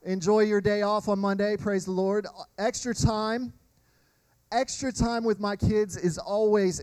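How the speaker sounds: ordinary talk, nothing marked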